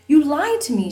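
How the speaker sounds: high, loud hurt accusation; crying out. Acted. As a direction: fling it at them, betrayed. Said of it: "she cried".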